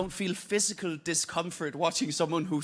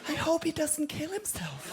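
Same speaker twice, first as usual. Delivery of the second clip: pained concern; 10 semitones higher than usual. Acted. Acting a pained comedy audience.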